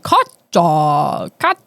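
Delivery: "Katya" as KOT-chaw, low, broken in two; crazy pronunciation. Retold or imitated. Speaker mocking the YouTube recordings of her name, crazy pronunciation. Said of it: "was saying it crazy".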